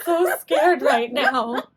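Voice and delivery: loud, drawn-out pretend fright; hammed-up whimpering fear; mock terrified. Ghost hunter on the trail, hammed-up terror.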